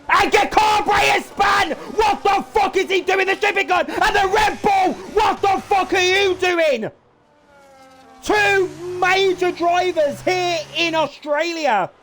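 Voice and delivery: loud, high, stunned outcry; shocked shouting. Real, not acted. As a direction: yell it in disbelief. Crash on track, live reaction.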